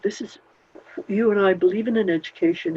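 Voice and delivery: normal talking voice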